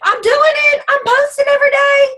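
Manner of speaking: high, loud, sing-song imitation of a proud poster; hammed-up high mock voice; mocking a boastful poster. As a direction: parody her bragging in a squeaky voice.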